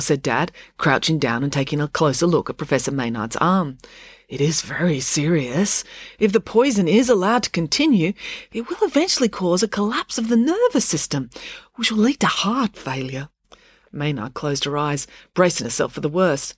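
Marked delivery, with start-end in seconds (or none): 4.3-13.3 careful, higher academic voice; Dad, grave scientist. Acted. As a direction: deliver the diagnosis earnestly.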